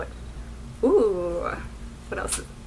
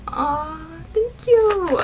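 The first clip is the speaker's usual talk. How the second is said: touched; 8 semitones higher, much slower than usual. Real, not acted. Touched by the gift.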